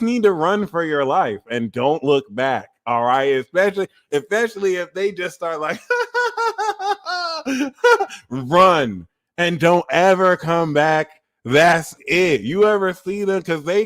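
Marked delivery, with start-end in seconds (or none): 5.9-8.2 high, unhinged cackle verging on wailing; mock crying laugh. Imitating a Sagittarius crying and laughing, mock crying laugh. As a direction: fake a long high-pitched crying laugh. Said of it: "a Sagittarius crying and laughing".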